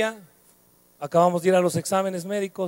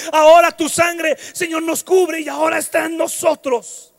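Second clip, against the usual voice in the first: louder, higher, half-sung prayer over music; fervent preaching prayer; 6 semitones higher, 5 dB louder than usual. Acted. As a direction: pray it out at full force, almost singing.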